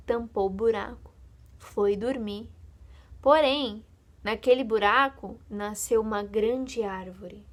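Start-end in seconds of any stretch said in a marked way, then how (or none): none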